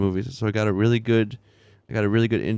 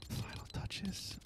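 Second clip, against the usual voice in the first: whisper; 10 semitones higher, 22 dB quieter, slower than usual. Real, not acted. Repeats phrase as a whisper.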